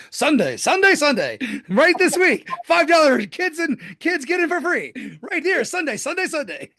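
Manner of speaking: high, hyped announcer voice, laughing through; mock monster truck radio ad. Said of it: "when you say monster truck, I just think".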